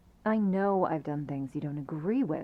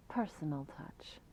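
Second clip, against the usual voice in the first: whispered aside; 3 semitones lower, 10 dB quieter than usual. Acted. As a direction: drop to a sly whisper on the last words. Visitor lowers voice meaningfully.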